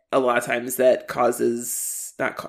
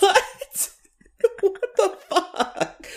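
much higher, slow, laughing what the fuck; laughing disbelief; slower than usual. Real, not acted. Reacting to the show's ending, laughing incredulously. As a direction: laugh through the words in disbelief. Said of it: "laughing at the ending".

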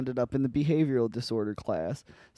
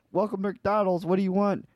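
drive-through worker; 6 semitones higher than usual. Retold or imitated.

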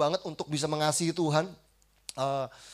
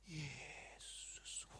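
"Yesus" stretched out in a whisper; hushed, long-drawn devotional whisper; even slower prayer; 4 semitones lower, 18 dB quieter than usual. Retold or imitated. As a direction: breathe "Yesus" out very slowly. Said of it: "Lebih lambat lagi".

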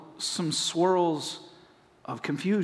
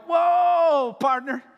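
high, loud startled whoa; hammed-up alarmed shout; mock shout; 10 semitones higher, 6 dB louder, slower than usual. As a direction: yell it like stopping a runaway horse. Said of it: "I'm gonna go".

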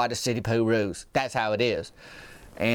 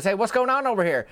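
higher, upbeat friendly call; cheerful greeting; 9 semitones higher than usual. Retold or imitated. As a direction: greet them in a good mood. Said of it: "He goes".

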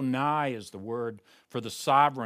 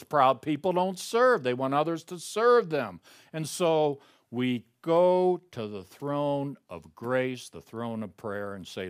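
ordinary talk, nothing marked

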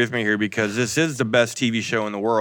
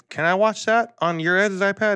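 much higher, lightly voiced little kid; small child asking; 10 semitones higher than usual. Three-year-old asking parents for the iPad, imitated child voice. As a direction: imitate a toddler's request.

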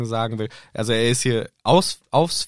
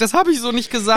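voicing Hermine; 9 semitones higher, 4 dB louder than usual. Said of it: "Und Hermine sagt".

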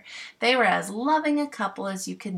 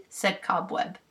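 usual speaking voice

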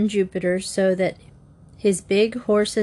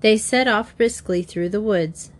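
ordinary delivery, nothing marked

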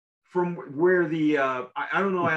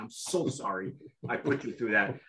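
ordinary talk

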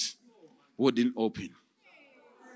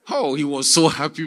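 ordinary talk, nothing marked

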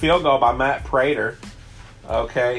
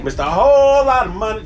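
higher, louder hype call; hype announcer; 7 semitones higher, 6 dB louder than usual. Acted. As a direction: shout the nickname like an announcer. Hyped echo of nickname.

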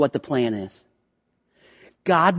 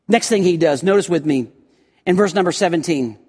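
usual speaking voice

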